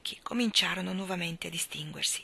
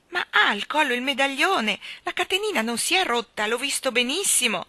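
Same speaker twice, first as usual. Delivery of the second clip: anxious insistence; 5 semitones higher, 5 dB louder than usual. A narrator's reading.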